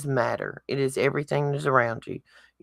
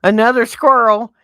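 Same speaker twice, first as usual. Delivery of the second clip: loud; 7 semitones higher, 10 dB louder than usual. Real, not acted. Joking about distraction.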